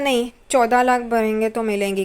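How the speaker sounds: usual speaking voice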